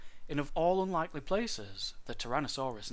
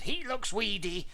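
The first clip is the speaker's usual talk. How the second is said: high-pitched grumble; complaining mother dinosaur; grumbling; 7 semitones higher than usual. A narrator's reading. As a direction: grumble about the baby, pitch raised. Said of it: "she grumbled".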